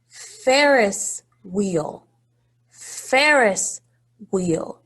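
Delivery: Ferris wheel drawn out slowly; slow model word. Shown as a demonstration. Teacher slowly modelling a word for children. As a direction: stretch Ferris wheel for listening. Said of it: "Do you hear a s".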